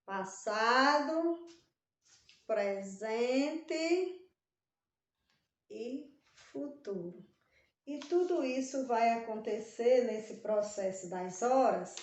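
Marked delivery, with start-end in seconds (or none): 0.0-7.2 each word stretched slowly with pauses between; slow teaching list. Shown as a demonstration. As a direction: spell out the three tenses deliberately. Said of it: "o tempo pode ser contado em".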